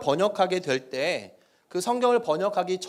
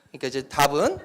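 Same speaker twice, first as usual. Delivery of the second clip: normal talking voice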